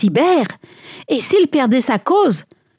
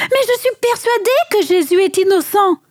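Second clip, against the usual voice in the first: high-pitched, insistent cry to a crowd; 11 semitones higher than usual. A narrator's reading. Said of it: "Il cria à la foule". (crier à la foule, voix aiguë)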